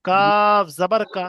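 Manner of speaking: slow drill with long-held letter names; deliberate, chant-like spelling aloud; spelling piece by piece. Shown as a demonstration. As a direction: stretch each letter, pause, then blend the syllables. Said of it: "কেটে কেটে বানান করবো".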